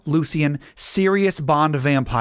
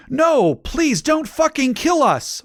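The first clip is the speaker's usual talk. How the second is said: very high, slow begging; desperate pleading; 13 semitones higher, 3 dB louder, slower than usual. A narrator's reading. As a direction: beg for your life, stretching each word. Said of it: "they pleaded".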